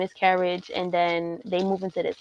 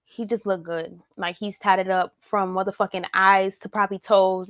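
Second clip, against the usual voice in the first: normal talking voice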